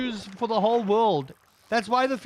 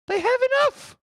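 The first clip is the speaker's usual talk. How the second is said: very high, louder squeal with enough rising at the end; shrieked exasperation; 4 dB louder than usual. Acted. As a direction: push the same line up into a squeal. Mocking the greedy rich.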